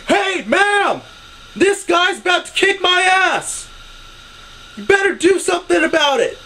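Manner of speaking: high, loud shout to a stranger; hammed-up public cry for help; imitated calling out. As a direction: yell it so the whole mall hears. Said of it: "calls out to this person".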